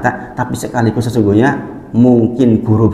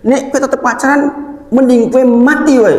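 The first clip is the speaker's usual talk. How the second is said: exasperated parent scolding; 14 semitones higher than usual. Retold or imitated. Fed-up father forbidding his son to date, high scolding. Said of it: "jengkel banget".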